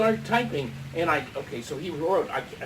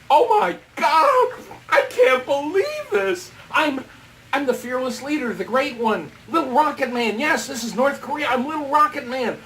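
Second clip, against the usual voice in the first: high, loud, hammed-up shock; flabbergasted outburst, starting huge; astonished exclamation; 6 semitones higher, 6 dB louder than usual. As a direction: cry out in stunned disbelief. Shocked discovery in the mirror.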